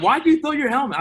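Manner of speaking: mock astonished teammate quizzing him; puzzled teammate. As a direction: ask it like a startled teammate. Teammate asks about helmet.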